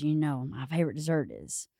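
soft, low imitation of a reserved man; quiet stoic uncle. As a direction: imitate a man of few words, pausing. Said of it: "not shy, but quiet".

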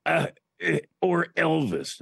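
hesitant grunts, then a drawn-out name; Chris Berman. Retold or imitated. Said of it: "And then he goes".

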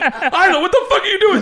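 high, laughing disbelief; stunned at your daughter's bid; high incredulous laughing yell; shocked dad. Retold or imitated.

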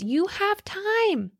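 imitating Gary Vee lightly; Gary Vee impression. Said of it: "he always says".

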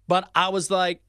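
ordinary delivery

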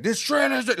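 high-pitched imitation of an excited friend; gushing fan. Imitating a friend who loved the trailer, gushing. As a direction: rave like you love it. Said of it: "he just loves the trailer".

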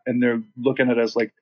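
normal talking voice

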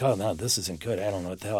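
low, soft and uneasy, under the breath; a quiet, rattled aside to himself; worried mutter. Retold or imitated. Nick worried after getting burned.

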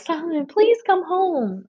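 high pleading wail, mimicking a panicked friend; panicked plea. Friend phoning the husband to come home, acted panic. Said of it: "she's panicking on the phone".

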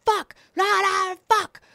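high yelled fuck la fuck; imitated screaming. Passenger screaming on the plane, high mock yell. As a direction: mimic a man yelling and cussing. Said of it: "I just hear him going".

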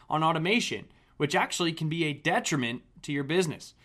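punchy, projected host announcement with lively pitch swings; polished scripted episode introduction; podcast intro read. Acted. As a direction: read the show intro crisply and emphatically. Host reads scripted show introduction.

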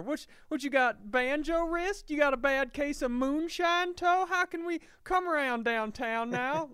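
high, loud hammed-up Southern country doctor; Southern accent. Comedian imitating a Southern country doctor, hammed up. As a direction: ham up a hillbilly doctor welcoming a patient. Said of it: "you started the Southern accent one word early".

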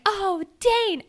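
a dreamy swoon over him; swooning sigh. Retold or imitated. Fan swooning over Dane, lovesick mock sigh.

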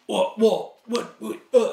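flustered stammer; imitating the surfer's flustered stutter. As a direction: stumble over the words, caught out. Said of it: "kinda stuttered".

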